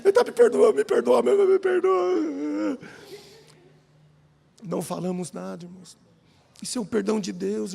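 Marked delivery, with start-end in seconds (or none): 0.0-2.8 higher, louder, half-sobbed pleas for forgiveness; tearful pleading. Retold or imitated. Both young men crying and hugging in the little room, imitated sobbing pleas.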